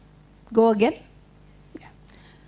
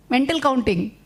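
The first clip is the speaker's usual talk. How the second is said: usual speaking voice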